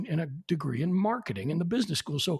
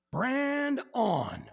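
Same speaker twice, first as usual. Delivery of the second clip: higher, drawn-out roll call; old professor calling roll; 7 semitones higher than usual. Retold or imitated. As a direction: call the name like a stern old prof.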